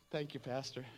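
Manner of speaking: lightly voiced meek, quiet little thanks; a soft hint of a timid man-child; Pee Wee Herman. Churchgoer thanking the pastor at the restaurant, meek and quiet. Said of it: "They speak like Pee Wee Herman at the restaurant".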